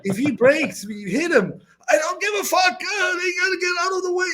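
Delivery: high, fast shouted threats; raging driver screaming over the wheel; retold furious shout. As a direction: rant at the traffic like a maniac. Franz raging behind the wheel.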